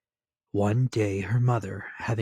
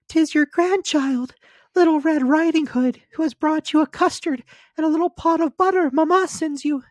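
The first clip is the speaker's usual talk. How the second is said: put-on much higher, louder child voice; little girl voice; 9 dB louder than usual. A narrator's reading. Little girl answering the wolf, high and childlike. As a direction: pipe it up like a small girl.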